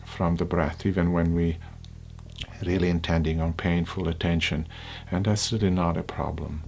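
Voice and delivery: unhurried, hushed guidance, phrase by phrase; guided meditation. Acted.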